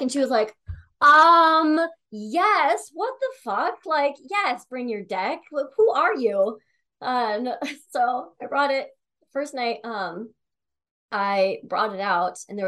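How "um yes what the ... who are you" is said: high, loud, incredulous friend voice; excited disbelief. Retold or imitated. Friend hearing about the tarot deck, high incredulous excitement. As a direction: play the friend, thrilled and baffled.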